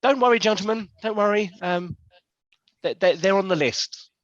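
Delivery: high-pitched hostess calming the men; feminine voice. Acted. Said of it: "a feminine voice sort of comes out".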